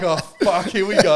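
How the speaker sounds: high and loud, laughing through oh fuck here we go; laughing dread. Retold or imitated. Telling a mate about the fullback nodding at him, laughing high and loud. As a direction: laugh as you brace for the hit.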